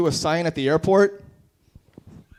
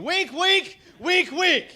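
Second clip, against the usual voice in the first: much higher, put-on wink wink; mock wink; 13 semitones higher, much slower than usual. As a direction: squeak out a sly hint. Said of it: "sometimes it gets me free cocaine".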